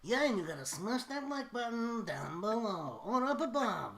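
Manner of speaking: high hype plug; YouTuber voice. Pretend. Jokingly plugging the stream, high hype. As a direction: rattle off the like plea.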